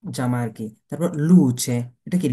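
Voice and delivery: ordinary talk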